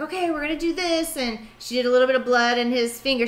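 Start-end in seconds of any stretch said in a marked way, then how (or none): 0.0-1.2 put-on higher, coaxing nurse talking to a child. Nurse reassuring a boy before his shot, coaxing. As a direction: coax a scared kid gently. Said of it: "she was like".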